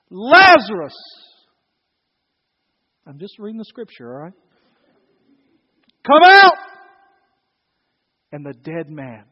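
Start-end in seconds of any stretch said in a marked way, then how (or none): 0.1-0.9 sudden booming call; loud shout. Acted. Jesus calling the dead man from the tomb, loud shout. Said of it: "with a loud voice". 6.0-6.6 full-force high commanding shout; loud shout. Acted. Jesus ordering the dead man out of the tomb, loud shout. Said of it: "with a loud voice".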